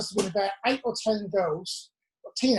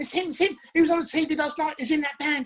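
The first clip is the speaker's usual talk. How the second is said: high-pitched retold fan excitement; high voice of a thrilled onlooker; excited fan, imitated; 7 semitones higher than usual. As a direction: imitate a girl excitedly telling her friend. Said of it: "I heard her saying".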